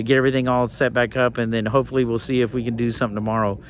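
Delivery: usual speaking voice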